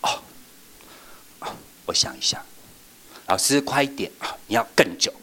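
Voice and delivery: drawn-out, hushed thinking aloud; slow pondering teacher. Shown as a demonstration. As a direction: take your time, pretend to mull it over. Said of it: "基督的东西是这样".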